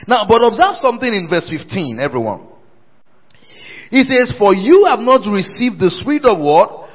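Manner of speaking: loud, high pulpit proclamation; full-force preaching. Real, not acted. Preacher reading the verse to congregation, full-force preaching. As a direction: proclaim the verse and call for a response.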